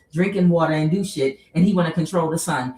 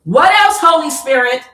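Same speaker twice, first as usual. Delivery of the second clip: loud, high-pitched call upward; 9 semitones higher, 10 dB louder than usual. Real, not acted. Reader calls to the Holy Spirit for more, calling out. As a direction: call out loudly, drawing out the words.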